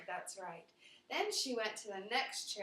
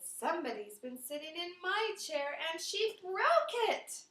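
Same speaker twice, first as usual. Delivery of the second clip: put-on Baby Bear building to wail; 7 semitones higher than usual. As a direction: start small, then cry out it's all gone.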